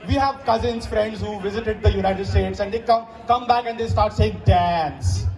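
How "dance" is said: mock American twang, pitched lower; fake American accent. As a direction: put on an exaggerated American accent. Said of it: "that's the fake American accent".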